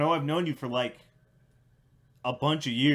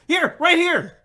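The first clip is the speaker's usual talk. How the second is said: eager shout; 12 semitones higher, 10 dB louder, slower than usual. Retold or imitated. Imitating Blaine eagerly volunteering.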